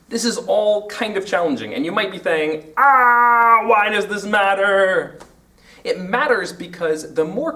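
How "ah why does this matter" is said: loud, drawn-out groaning complaint; mock exasperation. Teacher voicing a challenged student, hammed-up exasperated cry. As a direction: wail it slowly like a fed-up student.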